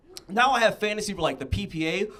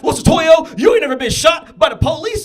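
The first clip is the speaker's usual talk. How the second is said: loud, higher mock shout from the friend; shouted retort; 10 semitones higher, 12 dB louder than usual. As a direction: yell it like a cheap gotcha. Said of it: "he was like".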